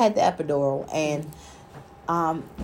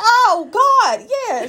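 high laughing exclaim; 13 semitones higher, 4 dB louder, much slower than usual. Real, not acted. Recalling the mother's outburst.

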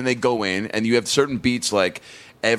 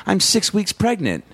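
higher cheesy commercial wife; hammy radio ad wife; 9 semitones higher than usual. Retold or imitated.

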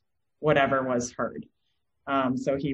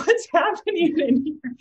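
surprised mother; 12 semitones higher, 5 dB louder than usual. Retold or imitated. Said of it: "I'm like".

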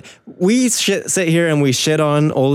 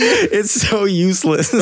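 high, slow words through real laughter; laughing; 7 semitones higher, slower than usual. Real, not acted. Podcaster cracking up at co-host's bit, laughing. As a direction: crack up while saying it.

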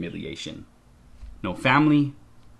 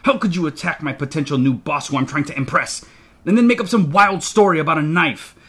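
heated rant, rising high and loud; furious scolding; 6 semitones higher, 5 dB louder than usual. A narrator's reading. Father scolds the child for attacking his potential boss, furiously. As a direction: tear into the child in exasperation.

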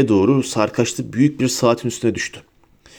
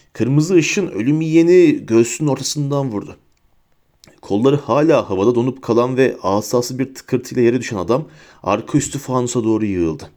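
ordinary delivery

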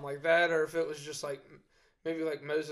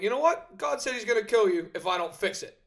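shouting; 7 semitones higher, 8 dB louder than usual. Retold or imitated. Moses finally tells the people.

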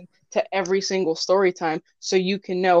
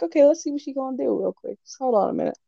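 quick, higher, scheming; sly anticipation; 6 semitones higher, faster than usual. Retold or imitated. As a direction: rush it like plotting mischief. Retelling their teen reaction, fast.

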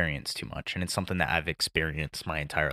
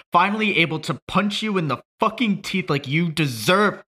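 rising from a rough build to a full, high yell; grieving son raging at his father's grave; furious shout; 11 semitones higher, 8 dB louder than usual. Acted. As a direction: scream it at the coffin, louder and higher each line. Monologue builds to raging at dead father.

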